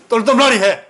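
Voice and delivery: loud, high call imitating card players; imitierter Ausruf. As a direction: call it out like a player demanding one last round. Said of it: "막 그렇게 한다고".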